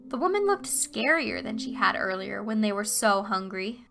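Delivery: plain reading voice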